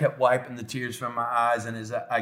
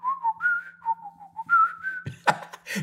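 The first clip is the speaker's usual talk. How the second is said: whistling a slow hymn tune; long whistled notes, imitating dad; imitated whistling. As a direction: whistle the melody in place of words. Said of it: "he was whistling".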